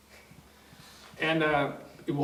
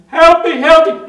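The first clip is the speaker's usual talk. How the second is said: high, very loud cry for help; acted panicked shouting; frantic plea; 22 dB louder than usual. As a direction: imitate a man begging in panic. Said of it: "The guy was freaking".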